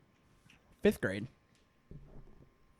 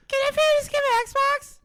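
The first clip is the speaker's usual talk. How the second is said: put-on little boy voice; 7 dB louder than usual. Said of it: "little Joey".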